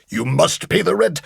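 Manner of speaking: menacing melodrama villain voice; mustache twirling villain. Retold or imitated. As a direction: sneer it like a stage villain. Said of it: "the villain would come in and would go".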